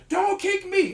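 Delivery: high, loud mock shout of don't kick me; a hammed-up yell he never gave. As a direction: yell it the way he says he didn't. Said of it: "I'm not yelling".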